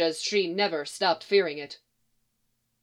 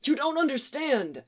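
high-pitched desperate plea; pleading; 6 semitones higher than usual. A narrator's reading. Young man facing Martha's shotgun, pleading to be understood. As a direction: beg her in a higher voice. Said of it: "he pleaded".

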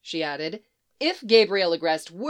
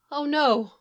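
whimpering; 6 semitones higher than usual. Acted. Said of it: "she whimpered".

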